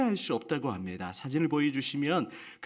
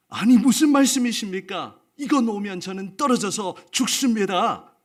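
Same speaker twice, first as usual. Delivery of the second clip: alarmed protest; 6 semitones higher, 8 dB louder than usual. Acted. Man refuses to let go.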